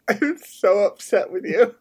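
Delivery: high, slow protest through laughter; laughing mock upset. Real, not acted. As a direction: complain while cracking up. Mock upset while laughing at co-host.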